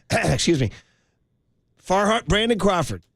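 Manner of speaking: normal talking voice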